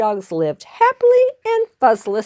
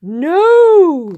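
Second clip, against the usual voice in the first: long, loud, high refusal; crowd yell; 9 semitones higher, 7 dB louder than usual. Acted. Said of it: "the crowd yelled".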